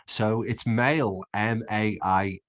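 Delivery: slow, letter-by-letter spelling of mail. Shown as a demonstration. Spelling mail for chat, slow and deliberate. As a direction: spell it M A I L, one letter at a time. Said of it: "not M L E".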